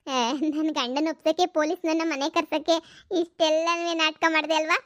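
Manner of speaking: ordinary delivery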